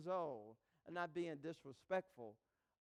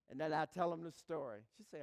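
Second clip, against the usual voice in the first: normal talking voice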